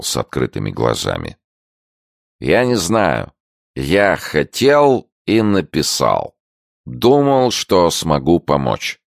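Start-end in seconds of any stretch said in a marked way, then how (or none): 2.4-9.0 put-on slow drunk voice. A narrator's reading. Drunk Kondratyuk answers slowly.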